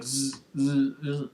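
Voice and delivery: short buzzing "zzz" bursts; mouth-made electrical crackle; imitated static buzz. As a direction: buzz like a glitching feed.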